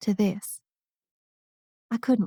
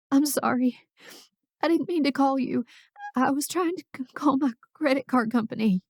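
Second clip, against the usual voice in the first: tearful; 5 semitones higher than usual. A narrator's reading. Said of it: "a sob hitching past my lips".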